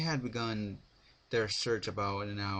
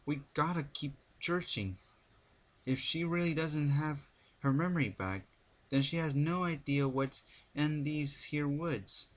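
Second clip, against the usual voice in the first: higher, firm country mare; determined Applejack; 6 semitones higher than usual. A narrator's reading. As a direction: press on with resolve, pitched up. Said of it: "Applejack stretched".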